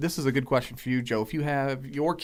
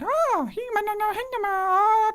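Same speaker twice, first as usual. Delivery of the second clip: very high mock-Japanese babble; mock game-girl squeals; 3 dB louder than usual. Podcaster imitating the characters in the first game, squealing falsetto. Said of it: "all you heard was them going".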